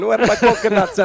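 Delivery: loud, high Jamaican shout; Jamaican accent. Pretend. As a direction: call it out in a hammed-up Jamaican accent. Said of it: "That's Jamaican".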